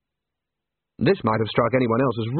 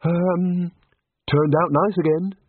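awkward small talk; 6 semitones higher, slower than usual. A narrator's reading. Awkward small talk with armed stranger.

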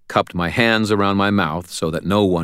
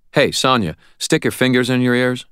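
raised voice, higher; calling out; 7 semitones higher, 4 dB louder than usual. A narrator's reading. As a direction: call over to him loudly. Harry calls to Sonya.

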